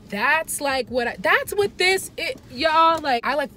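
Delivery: so excited she can't finish a sentence. Real, not acted. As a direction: bubble over with excitement, tripping on words. Excitedly explaining passion.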